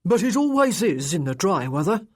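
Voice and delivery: put-on loud old servant voice; an old retainer chatting respectfully to his lord; put-on voice of an elderly servant; elderly manservant. A narrator's reading. Elderly manservant answers his master.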